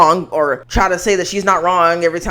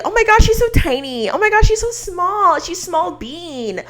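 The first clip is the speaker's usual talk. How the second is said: much higher, cooing fangirl squeal; gushing fan voice; 8 semitones higher than usual. Retold or imitated. Mocking fans in comment sections with a high gushing coo. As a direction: gush over a tiny celebrity. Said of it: "They literally be like".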